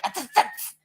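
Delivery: high mock tsk-tsk hissing; imitating an angry hiss; imitated hissing. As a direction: click your tongue like an annoyed daughter. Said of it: "hissing at her dad".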